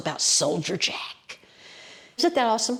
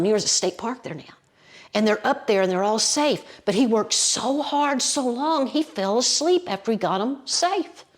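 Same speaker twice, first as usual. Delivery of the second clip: ordinary talk, nothing marked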